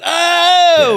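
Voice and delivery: very high, loud whooping shout; crowd whoop. Retold or imitated. Kids in the crowd whooping as the pair kiss, high and loud. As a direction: cheer like a whole crowd.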